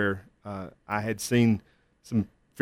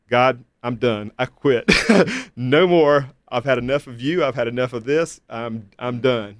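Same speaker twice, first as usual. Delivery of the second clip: angry outburst at God; 3 semitones higher, 6 dB louder than usual. Retold or imitated. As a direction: replay the night you gave up. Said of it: "being really angry at God and saying".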